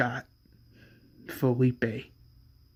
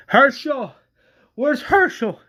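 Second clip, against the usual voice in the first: much higher, louder call for Herschel; calling out urgently; 10 semitones higher, 5 dB louder than usual. Acted. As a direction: shout for the doctor. Shouting for the doctor.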